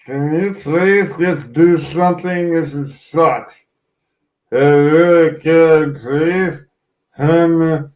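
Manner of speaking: slow, whimpering plea; whiny pleading. Retold or imitated. Patient in hospital bed begs mom, slow whiny pleading. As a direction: beg like a sick kid. Retold self in pain, pleading.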